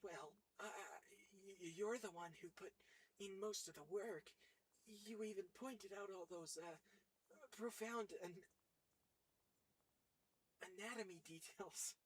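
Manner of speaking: high, hesitant stammering; stuttering character voice. Acted. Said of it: "doing that voice where I'm purposely stuttering".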